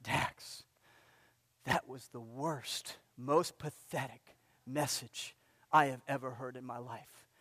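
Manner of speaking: low, slow, hushed sneer of a harsh judge; Simon Cowell voice. Retold or imitated. Imagined TV judge trashing the pastor's sermon, low and hushed. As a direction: murmur a brutal verdict like a TV talent judge. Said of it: "the voice of Simon Cowell in my ear".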